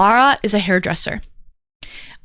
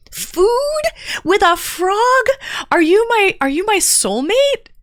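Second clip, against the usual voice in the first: high, excited squeal of recognition; delighted amazement; 11 semitones higher than usual. Real, not acted. Streamer to first time chatter with a perfect name, delighted. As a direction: gush in a much higher voice.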